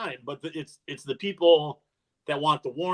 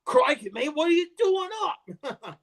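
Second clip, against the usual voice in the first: high, hammed-up Kiwi accent; New Zealand accent; 13 semitones higher than usual. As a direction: put on a big Kiwi accent. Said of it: "he's got the full-on New Zealand accent".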